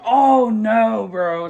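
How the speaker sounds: retold worried cry; alarmed concern. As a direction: react to your brother hurt. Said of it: "I was like".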